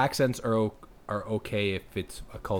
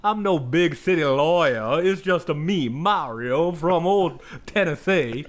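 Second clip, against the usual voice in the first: put-on high, loud, slow Southern accent; Southern Mario accent; 7 semitones higher, 7 dB louder, slower than usual. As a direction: play Mario as a Tennessee country boy with a Southern accent. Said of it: "He's just like".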